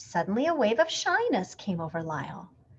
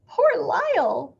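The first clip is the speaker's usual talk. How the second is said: sighed; 10 semitones higher, 6 dB louder, slower than usual. A narrator's reading. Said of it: "sighed Missus Prim".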